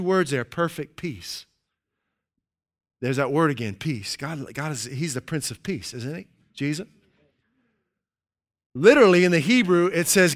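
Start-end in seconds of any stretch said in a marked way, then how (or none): none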